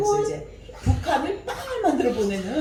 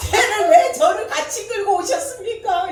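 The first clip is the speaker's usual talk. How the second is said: high, loud, laughing protest; retelling a frightened complaint, high and loud; mock panicked cry; 10 semitones higher, 8 dB louder than usual. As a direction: yelp it out half laughing. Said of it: "이러는 거예요".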